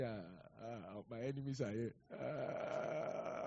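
mumbled low, defeated; a defeated half-groan about programming; low dismayed groan. Retold or imitated. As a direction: groan it under the breath.

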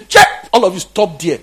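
the friend's loud shout to stop the crowd; retold shout. Pastor stops the crowd leaving the concert, with a loud shout. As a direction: bark it like a pastor halting the crowd. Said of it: "he says".

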